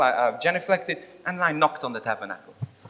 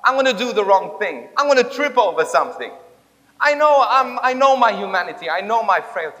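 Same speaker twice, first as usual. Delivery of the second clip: loud, raised, insistent confession; anxious protest; 5 semitones higher, 8 dB louder than usual. Retold or imitated. Rob warns Jesus he will sin, anxious loud protest. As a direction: pile up the worries forcefully.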